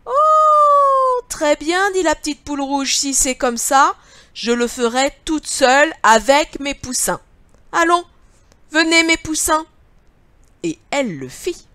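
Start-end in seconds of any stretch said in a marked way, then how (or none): 0.1-9.7 high, loud hen voice; little red hen. Acted. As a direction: cluck it out, high and bright.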